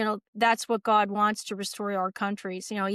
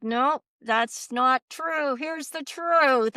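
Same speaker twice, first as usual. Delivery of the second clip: slow firm correction; 4 semitones higher, much slower than usual. Retold or imitated. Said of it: "I'm like".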